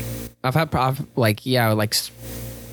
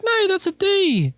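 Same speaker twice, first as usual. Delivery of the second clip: much higher, slow whiny protest; mock whiny student; slower than usual. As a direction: whine it like a cheeky kid. Own retort in retold exchange.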